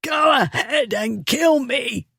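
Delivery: high, slow strangled voice; choked defiance. A narrator's reading. The bully dangling from the Duke's hands, high and choked. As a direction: force it through a squeezed throat. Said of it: "the fool choked out".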